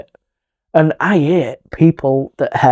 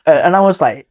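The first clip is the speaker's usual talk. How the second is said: ordinary talk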